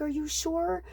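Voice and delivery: high, worried questioning; retold disbelief. Friend questioning the upset lady, acted disbelief. Reacting to stolen phone news.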